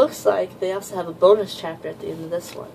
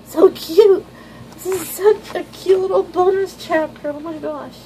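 much higher, drawn-out cooing; gushing squeal; 11 semitones higher, 6 dB louder, slower than usual. Real, not acted. Fan gushing over the bonus chapter, high drawn-out coo. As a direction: melt over how cute it is.

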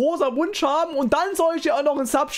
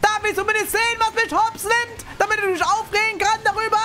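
very high-pitched gamer rage; outraged yelling; 10 semitones higher than usual. Real, not acted. Streamer knocked out without seeing why, outraged yelling. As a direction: yell in high, indignant disbelief.

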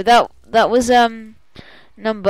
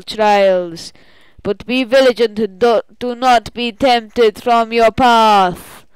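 raised, loud, measured ritual voice; solemn spirit guide; 4 semitones higher, 8 dB louder, slower than usual. Acted. Sending a player into the spirit world, solemn spirit guide. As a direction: intone a grave send-off as a spirit guide.

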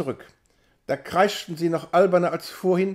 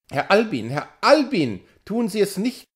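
shrill clamour; 4 semitones higher than usual. A narrator's reading. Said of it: "zeterten mehrere Stimmen".

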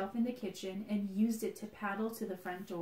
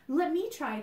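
higher, eager plea; eager cry; 6 semitones higher, 4 dB louder than usual. Acted. Basil begging to paint an egg, high and eager. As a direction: jump in eagerly. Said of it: "cried Basil".

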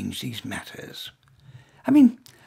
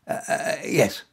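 ordinary delivery

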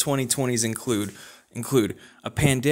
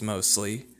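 ordinary talk, nothing marked

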